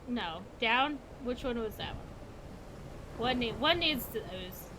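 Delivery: ordinary delivery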